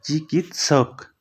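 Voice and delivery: चिकित्सक said slowly, each syllable separate; teacher enunciating चिकित्सक slowly; slow careful pronunciation. Shown as a demonstration. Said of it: "चिकित्सक होता है".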